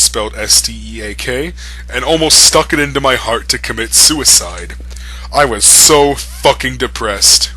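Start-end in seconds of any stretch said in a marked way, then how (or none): none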